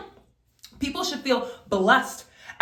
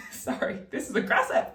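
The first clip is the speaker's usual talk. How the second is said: breathy laugh through the words; laughing apology; 3 dB quieter than usual. Real, not acted. Apologizing to viewers after yelling, laughing. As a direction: laugh it off sheepishly.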